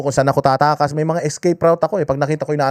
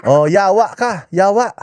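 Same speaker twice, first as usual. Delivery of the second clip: parent cursing; 4 semitones higher, slower than usual. Retold or imitated. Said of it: "nagmumurahan na yung mga magulang nila".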